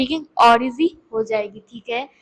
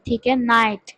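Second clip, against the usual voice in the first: ordinary talk, nothing marked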